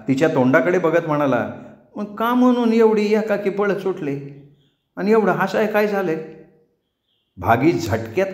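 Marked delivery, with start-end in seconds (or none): 2.0-6.2 put-on higher childish voice, bewildered; lightly voiced confused boy; puzzled boy. A narrator's reading. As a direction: ask why she ran off.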